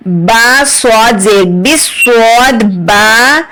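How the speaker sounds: normal talking voice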